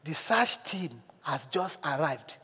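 put-on slow, hushed child's reply; quiet tone. Child updating a worried caller, quiet and slow. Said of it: "answered in a quiet tone".

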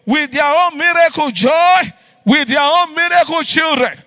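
loud, fervent shouted prayer; fervent prayer preaching. Acted. Pastor praying over the families, fervent shouted prayer. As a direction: pray out over the congregation with rising fervour.

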